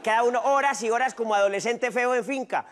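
usual speaking voice

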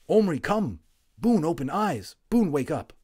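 loud urgent call. A narrator's reading. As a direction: call across the table. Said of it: "Little Bear suddenly called".

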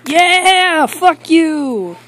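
high, loud drawn-out holler; rowdy yell. Acted. Scout crashing the talk show couch, rowdy defiant yell.

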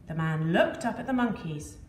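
usual speaking voice

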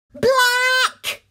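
loud high shouted word; dramatic shout. A narrator's reading. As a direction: burst out dramatically. Dramatic blackout moment.

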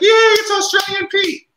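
put-on high, loud, slow kids' cheer; excited kids cheering. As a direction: shout it like thrilled children. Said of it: "the kid's like".

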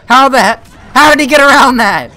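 high and loud disbelief; shocked. Real, not acted. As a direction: cry out in disbelief. Shocked at the killer.

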